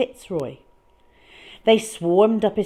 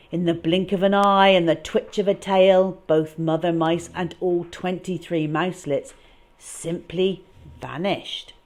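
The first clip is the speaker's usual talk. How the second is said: ordinary delivery, nothing marked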